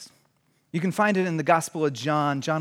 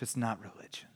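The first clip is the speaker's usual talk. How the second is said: whispered close; 4 semitones lower, 10 dB quieter than usual. Acted. Pastor closing the sermon for the congregation, whispered. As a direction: hush it down to close.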